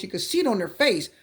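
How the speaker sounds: ordinary talk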